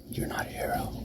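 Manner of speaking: very soft, whispered final verdict; menacing whisper. Acted. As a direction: let the last blow fall in a whisper. Final verdict on his rival.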